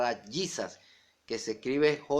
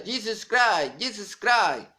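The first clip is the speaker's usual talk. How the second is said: higher-pitched English "Jesus Christ" chant; imitated gringo English; 6 semitones higher, slower than usual. Preacher imitating arriving gringos, English-accented proclaiming. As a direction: mimic English-speaking preachers crying the name. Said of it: "ellos empiezan a pronunciar".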